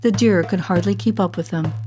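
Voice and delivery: ordinary delivery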